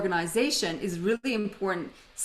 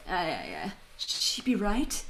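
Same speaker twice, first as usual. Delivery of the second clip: attempted Australian accent; 5 dB quieter than usual. Husband waving off his wife's worry about snow, attempted Australian accent. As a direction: imitate an Australian husband, slow and dismissive. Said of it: "with the right Australian accent".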